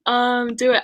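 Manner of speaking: ordinary talk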